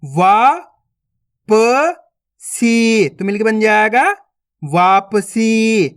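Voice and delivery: each syllable spaced out, then the word blended slowly; syllable-by-syllable reading. Shown as a demonstration. Teacher to young students: slow sounding-out of each syllable.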